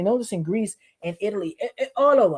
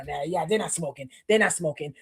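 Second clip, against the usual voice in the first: 1 lightly voiced hosts; 6 semitones lower, 5 dB quieter than usual. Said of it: "the people that we were with".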